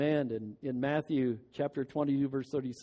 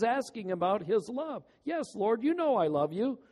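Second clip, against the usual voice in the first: fervent preaching; 8 semitones higher, 7 dB louder than usual. Acted. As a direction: preach it with mounting fervor. Preacher rises to full force.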